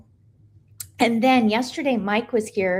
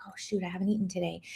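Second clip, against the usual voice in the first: muttered self-quote; 3 semitones lower, 9 dB quieter, much faster than usual. Retold or imitated. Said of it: "And I'd be like".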